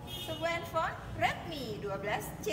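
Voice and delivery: high, slow drawn-out prize reveal; booming prize announcer. Acted. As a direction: unveil the prize like a game show.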